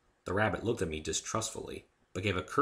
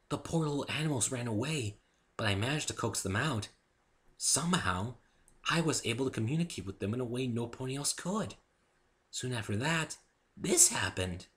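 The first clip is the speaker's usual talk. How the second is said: gentle Fluttershy voice; 5 semitones higher than usual. Acted. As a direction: speak sweetly and timidly.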